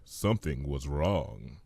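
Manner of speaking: ordinary delivery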